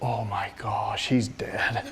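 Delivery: slow, low stage whisper; hushed dread. Retold or imitated. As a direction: whisper it in mock horror. Awed private thought at friend's outburst.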